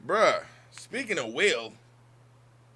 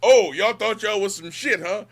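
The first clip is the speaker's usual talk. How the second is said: loud taunt, higher and louder; mock taunting rival team; 5 semitones higher, 6 dB louder than usual. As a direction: gloat like a rival player.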